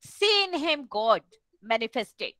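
ordinary talk, nothing marked

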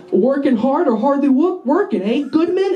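high, cheesy buddy banter; jokey schmoozing. Acted. Student planning a joke on his teacher, jokey schmoozing. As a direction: lay on the corny chumminess, lifting the name.

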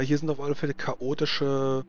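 usual speaking voice

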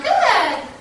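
bright high burst of praise; excited praise. Real, not acted. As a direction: cheer the dog on, high and loud. Praising dog after command.

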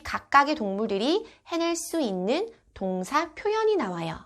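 normal talking voice

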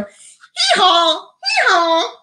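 high, two-note hee-haw braying; donkey bray. Shown as a demonstration. Librarian making a donkey noise for children, high braying hee-haw. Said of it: "I'm gonna make a donkey noise".

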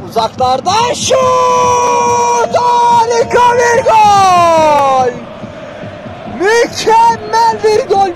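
drawn-out, slow, roaring goal call; screaming football commentator. Real, not acted. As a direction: yell the goal, stretching every word. Commentator calls a long-range goal. (bağıran futbol spikeri)

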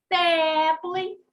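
теплий with a long, loud «е»; stressed check word. Shown as a demonstration. Teacher gives the check word, теплий with drawn-out «е». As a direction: hold the first vowel of теплий. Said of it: "добираємо перевірне слово".

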